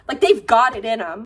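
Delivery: normal talking voice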